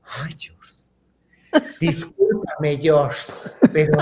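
whispered, voiceless mock suffering; acted breathy whisper of misery; feeble groan. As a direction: sigh it out weakly, barely audible.

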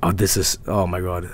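low, hushed muttering; quiet dread. Real, not acted. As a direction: murmur uneasily to yourself. Dreading replay.

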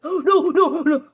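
high, loud panicked no, no; panicked cry. Real, not acted. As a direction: scream in real panic as you nearly die. Panicked gamer about to die.

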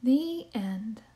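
gentle read-aloud storytime voice; story narration. A narrator's reading. Librarian reading a picture book to kids, storytime narration.

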